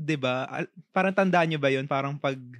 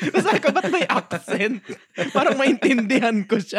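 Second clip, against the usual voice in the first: higher, louder, through laughter; cracking up while talking; laughing; 10 semitones higher, 4 dB louder than usual. Real, not acted. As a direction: laugh your way through the retelling. Laughing while retelling the accent story.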